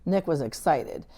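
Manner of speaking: ordinary delivery, nothing marked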